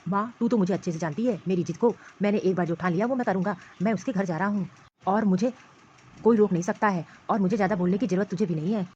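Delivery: a possessive man fuming and threatening; angry husband. Acted. Husband rages to mother that his wife left without asking.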